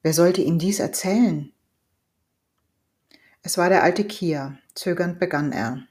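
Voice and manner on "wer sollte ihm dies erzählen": ordinary delivery, nothing marked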